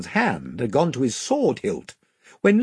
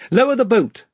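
higher, louder king's call; crying out; 11 semitones higher, 6 dB louder than usual. A narrator's reading. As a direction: call the order across the deck. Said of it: "cried Caspian".

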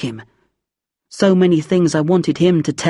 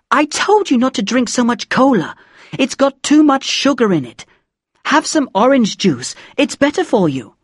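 high, scolding mother's voice; nagging mum; 7 semitones higher than usual. A narrator's reading. Mum checking on her child's schoolwork, nagging. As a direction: pester a child with raised pitch. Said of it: "she'd be after me about my meals".

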